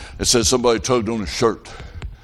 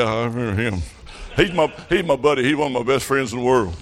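ordinary talk, nothing marked

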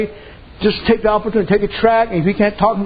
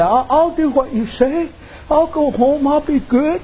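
high, meek promising husband; 8 semitones higher, slower than usual. Retold or imitated. Husband promising his wife to be good, mock meek. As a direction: promise it like a scolded boy.

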